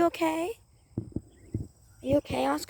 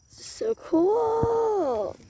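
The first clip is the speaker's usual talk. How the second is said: excited; 9 semitones higher, much slower than usual. Pretend. Same words drawn out high.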